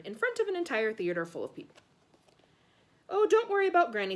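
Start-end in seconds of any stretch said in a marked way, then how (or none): 3.1-4.2 higher, louder breezy mum voice; a mum waving off her son's worry; dismissive mum. A narrator's reading. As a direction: brush off the worry lightly. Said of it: "said Mom".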